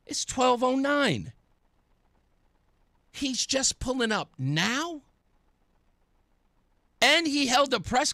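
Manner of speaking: slow, high-pitched disbelief; retold incredulity. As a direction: draw it out in mounting disbelief. Retells disbelief at the late arrival.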